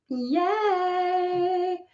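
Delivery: high, held, sung-out yay; sing-song cheer. Real, not acted. Streamer cheering her camera fix, sing-song cheer. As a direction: draw it out high on one note.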